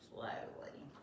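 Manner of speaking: a soft whisper on the word slowly. Shown as a demonstration. Teacher reading slowly to children, whispered word. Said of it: "whispered word in reading".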